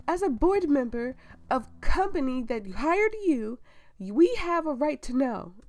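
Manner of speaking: loud, high, indignant outburst; angry board member. Acted. Board member demanding answers from the hired doctor, loud indignation. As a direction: demand answers at full volume.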